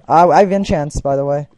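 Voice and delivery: normal talking voice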